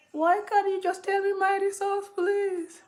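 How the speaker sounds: imitated crying plea in a very high voice; tearful pleading. Crying patient begging the call handler for results, tearful pleading. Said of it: "and I start crying".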